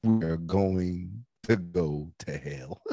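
low, deliberate doom announcement; slow pronouncement. Real, not acted. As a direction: draw each word out like a verdict. Slow, solemn final thought.

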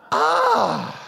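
high, loud mock-angry "ah"; acted cry of pent-up frustration; frustrated yell. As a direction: let out an exasperated yell. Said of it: "I wanna be mad".

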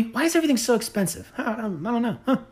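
soft, fast puzzled voice; a hushed clueless voice; mock confused shopper. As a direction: play a baffled shopper, hushed.

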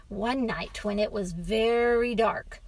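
ordinary delivery, nothing marked

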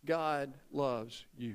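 slow, soft and low; tender declaration. Real, not acted. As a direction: let each word sink in gently. Slow tender close of congregational declaration.

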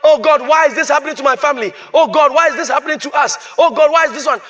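put-on "why me" prayer; mock complaining prayer. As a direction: imitate someone pleading in complaint. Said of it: "now your prayer is not".

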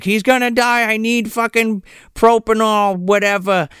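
a hint of panicked begging; mock desperation. Host imitating the plea at the doctor's house, mock desperation.

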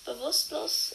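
ordinary delivery

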